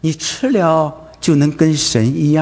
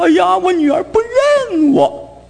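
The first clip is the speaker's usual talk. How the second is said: high, slow, wailing lament; a mother crying to her pastor, imitated high and slow; mock tearful complaint; slower than usual. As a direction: whine it like a heartbroken mother imitated. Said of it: "妈妈来跟我哭诉".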